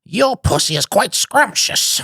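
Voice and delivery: high, slow, posh British voice; posh British accent. Acted. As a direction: ham up a British gentleman savouring each word. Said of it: "when I do that".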